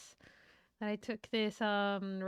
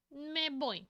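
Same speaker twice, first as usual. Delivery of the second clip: ordinary talk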